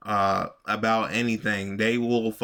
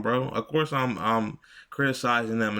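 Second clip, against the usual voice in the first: ordinary talk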